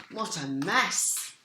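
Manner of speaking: usual speaking voice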